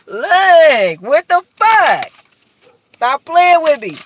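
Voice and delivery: loud, high, exasperated outburst; mock outrage. Real, not acted. Shuffling tarot cards, loudly fed up with them. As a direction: snap at the deck like it's messing with you.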